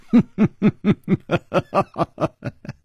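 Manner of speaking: long, high staccato villain laugh; shrill mocking cackle; harsh laugh. A narrator's reading. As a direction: laugh sharply and at length. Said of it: "尹姬厉声笑了".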